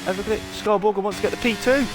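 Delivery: high, excited final-lap commentary; motorsport commentator. Acted. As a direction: build the hype to the finish. Live race play-by-play.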